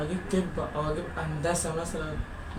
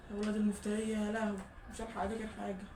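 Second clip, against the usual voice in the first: much softer, worn-out murmur; too exhausted to push the voice; real late-night fatigue, head aching; drained and tired; 13 dB quieter than usual. Real, not acted. Exhausted after five-hour blackout.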